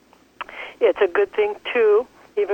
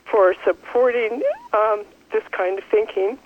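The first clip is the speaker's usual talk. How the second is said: normal talking voice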